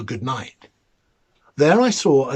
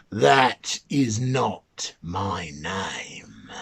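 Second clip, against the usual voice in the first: lower, very slow and softer mannikin voice; mannikin refusing; 10 semitones lower, 8 dB quieter, much slower than usual. Acted. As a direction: stretch the words low and smug. The mannikin rejects the guesses again.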